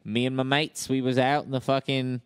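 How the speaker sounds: matey put-on Aussie accent; Australian accent. Australian telling co-hosts about a night out, Australian accent. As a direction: tell a yarn as an Australian. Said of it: "Australian accent".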